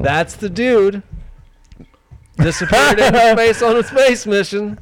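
mock dramatic.

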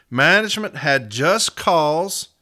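"just cause" slow, loud and drawn out; emphatic quoting. Retold or imitated. Advocate reading management's own words to the arbitrator, emphatic. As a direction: read it out slowly, hammering "just cause".

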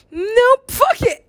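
high, emphatic refusal; mock refusal. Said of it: "you get up there and you're like".

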